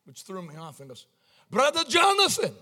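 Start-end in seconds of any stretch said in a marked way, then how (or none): 1.5-2.5 high, delighted Central African greeting; imitated Central African accent. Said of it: "like Central African".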